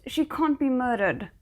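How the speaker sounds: usual speaking voice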